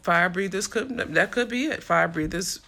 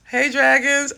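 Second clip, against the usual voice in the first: high, loud greeting; excited; 6 semitones higher, 7 dB louder, slower than usual. Real, not acted. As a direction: hail the group, delighted. Tries out the greeting to viewers.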